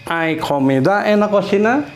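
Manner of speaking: "Hay comida en la cocina" high, with a rising finish; question intonation. Shown as a demonstration. Teacher reads the translated question to students, questioning intonation. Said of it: "tapi intonasinya yang berbeda".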